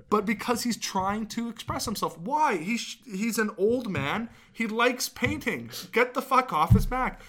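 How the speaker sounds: high, slowed, heated defence. Real, not acted. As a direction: get fired up defending him. Defending George W. Bush's paintings.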